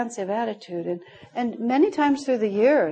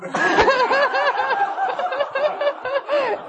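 long real laugh, pitched up; high burst of laughter. Real, not acted. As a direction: laugh freely at the joke. Laughs at her own punchline.

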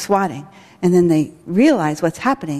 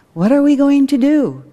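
a higher, louder anxious plea; worried plea; 7 semitones higher, 5 dB louder than usual. Retold or imitated. Parents ask Helen for help, mock anxious plea. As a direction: voice a panicked parent asking for help.

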